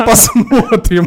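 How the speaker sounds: high, loud, slow laughing mimic of wife; mock wife, laughing. Laughing, imitating the wife's ominous reply. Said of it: "и она такая, типа".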